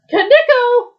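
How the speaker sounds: loud, very high yelled call; full-voiced searching yell. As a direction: yell for the missing friend. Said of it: "I yelled her".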